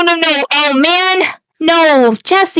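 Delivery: ordinary talk, nothing marked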